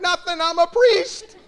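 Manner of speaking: high, loud yell with a laugh in it, slow; yelled back. Retold or imitated. Priest yelling back at the furious father, laughing. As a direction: shout it back half laughing. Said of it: "Of which I yell back".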